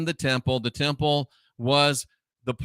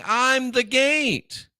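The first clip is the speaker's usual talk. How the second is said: higher, drawn-out "I'm the gate"; emphatic quote; 8 semitones higher, much slower than usual. Retold or imitated. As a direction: stretch "gate" high, then let it fall. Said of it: "I think about Jesus saying".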